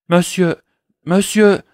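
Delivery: high, rising desperate cry; wife's terrified plea, rising. Acted. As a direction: cry out in panic. Wife cries out in terror.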